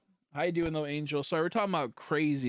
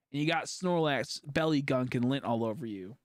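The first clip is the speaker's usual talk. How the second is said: ordinary delivery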